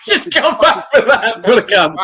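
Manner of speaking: laughing high while swearing back. Real, not acted.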